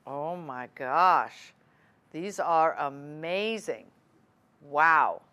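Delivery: slow, low, drawn-out wonder; awed gasp. Real, not acted. Host wearing the earrings on air, awed and slow. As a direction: let the wow sink in as you see them.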